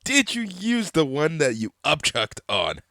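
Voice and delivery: ordinary talk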